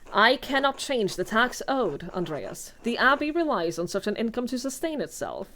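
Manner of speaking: ordinary talk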